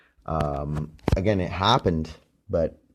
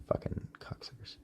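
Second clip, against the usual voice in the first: whispered curse; 18 dB quieter than usual. Real, not acted. Muttered insult.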